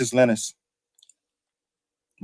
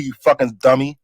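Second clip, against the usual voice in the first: ordinary talk, nothing marked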